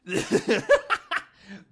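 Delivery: high, loud laughter; cracking up. Real, not acted. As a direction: burst out laughing before a bit. Cracking up before the impression.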